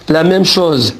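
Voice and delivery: usual speaking voice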